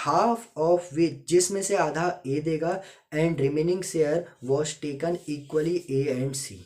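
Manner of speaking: slow, deliberate classroom dictation. Shown as a demonstration. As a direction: dictate it slowly so students can write. Reads question slowly, dictation style.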